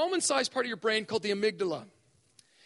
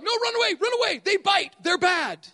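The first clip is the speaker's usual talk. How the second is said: louder, higher frantic warning; mock panic; 6 semitones higher, 7 dB louder than usual. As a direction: yell like a scared child spotting a dog. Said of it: "that says every time you see a dog".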